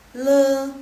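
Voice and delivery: le said very loud and clear; quiz syllable. Shown as a demonstration. Teacher reading a quiz syllable for students, loud. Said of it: "Sekali lagi".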